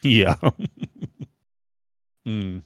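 ordinary talk

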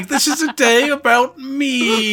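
very high, slow, hammed-up royal proclamation; mock Christmas speech. Partner mocks her childhood Christmas speech, hammed-up and pompous. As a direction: lampoon a pompous child's address. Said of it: "a bloody Christmas speech".

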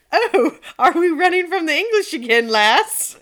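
loud, high, hammed-up Scottish lilt; big comic Scottish accent, barely holding back laughter; put-on Scottish accent. Peasant DNA speaking up in a meme, hammed-up Scottish accent. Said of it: "I have to do it with an accent".

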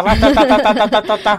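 rapid playful rattle of gunshots; comic rat-a-tat burst; imitated machine-gun fire. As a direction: mimic automatic fire with the tongue. Imitates rifle fire from truck window.

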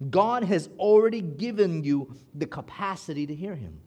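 usual speaking voice

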